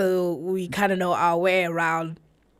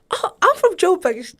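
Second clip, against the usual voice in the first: high, loud imitation of eagerness; excited reply; 10 semitones higher, 7 dB louder than usual. Woman telling a new acquaintance her city, excitedly. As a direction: pipe up as if finding a hometown match. Acted meeting of supposed compatriots.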